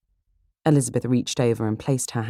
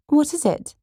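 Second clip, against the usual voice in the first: put-on high, loud girlish curiosity; high-pitched, keen little sister; eager question; 4 dB louder than usual. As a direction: demand the news excitedly. Kitty eager for the news.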